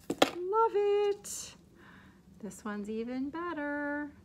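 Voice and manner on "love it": squeaky high burst of delight; much higher, louder, gushing. Real, not acted. She gushes over a tassel to her viewers.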